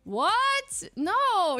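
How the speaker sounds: high, loud what, no; startled outburst of disbelief; shocked disbelief. Retold or imitated. Leslie replying to Ray's apology, loud and shocked.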